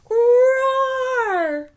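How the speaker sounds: high, loud beastly roar; monster roar. Acted. Tank waking as a monster, roaring.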